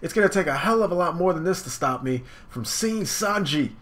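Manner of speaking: high, loud defiant hero; Luffy daring Cracker to bring it. Acted. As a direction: challenge him, high. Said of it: "Luffy's like".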